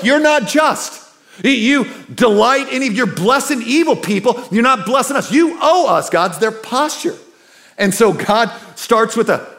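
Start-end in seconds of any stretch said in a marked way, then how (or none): 0.0-6.1 accusing God. Retold or imitated. The people accusing God, high and indignant. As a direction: hurl the blame upward, pitched high. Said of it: "And they accuse, like".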